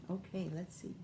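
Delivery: ordinary delivery, nothing marked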